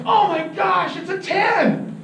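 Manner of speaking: high, stretched-out awe at a huge catch; amazed excitement. Acted. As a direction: gush over the fish, drawing out the words. Friend amazed at the fish.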